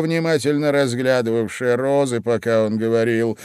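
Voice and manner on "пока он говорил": plain reading voice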